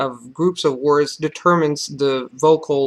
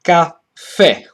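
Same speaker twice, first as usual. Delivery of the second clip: slow "caffè", high ka, pause, loud falling FÈ; stress on last syllable; 4 semitones higher, 8 dB louder than usual. Shown as a demonstration. Teacher showing there is no neutral syllable, slow "caffè" stressed at the end. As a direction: split "caffè" in two and punch the FÈ. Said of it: "stress falls on the last syllable".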